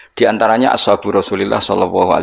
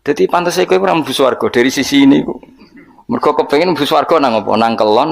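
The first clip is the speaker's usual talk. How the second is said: normal talking voice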